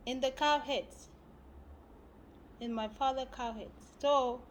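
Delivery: ordinary delivery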